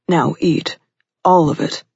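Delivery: firm command in short bursts; a hint of drill sergeant, ordering her. A narrator's reading. Man ordering his lover to eat, mildly commanding. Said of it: "he ordered like a drill sergeant".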